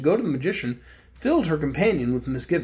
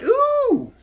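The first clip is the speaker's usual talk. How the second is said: high excited ooh; a thrilled little squeal of ooh; 4 dB louder than usual. Real, not acted. Teasing next chapter.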